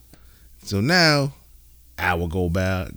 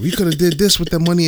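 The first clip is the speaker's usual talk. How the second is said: mock complaining wife, pitched up; wife hating; 8 semitones higher, 4 dB louder than usual. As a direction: imitate a wife's objection in a higher voice. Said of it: "At first, she was hating".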